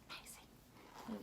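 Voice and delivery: breathy awed whisper; a hushed, amazed whisper. Real, not acted. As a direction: hush it like a secret wonder. Awed aside to kids.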